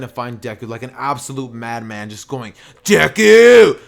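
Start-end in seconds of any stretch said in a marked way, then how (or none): 2.8-3.8 furious madman's yell of a name; full-force, high-pitched holler; screaming. Acted. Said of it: "just screaming".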